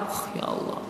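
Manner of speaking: breathless, shaken whisper; trembling whisper. Acted. Umar overhearing the confession, trembling whisper. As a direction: gasp it out, shaken to the core. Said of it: "Bergetar Umar".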